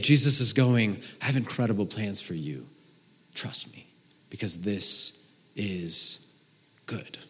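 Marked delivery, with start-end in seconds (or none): 1.2-7.2 soft, low, with weighty pauses; tender reassurance. Acted. Jesus promises good plans to his followers, tender. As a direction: drop to a near-whisper and reassure.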